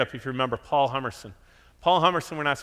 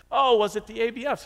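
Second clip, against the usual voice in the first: higher and louder, hopeful; mock eager guess; 10 semitones higher, 5 dB louder than usual. Pastor asking owner why he chose church, mock eager. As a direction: fish for a compliment. Retold chat with shop owner.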